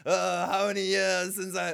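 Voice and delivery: put-on slow, strained old maester voice; old Pycelle voice. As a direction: drag it out like a creaky old man. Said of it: "I won't keep that up, it hurts my voice".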